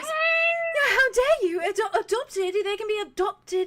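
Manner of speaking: very high, loud, hammed-up indignation; tiefling hamming up outrage at the guard, high and loud; mock outrage. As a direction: play offended on her new sister's behalf. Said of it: "And she goes".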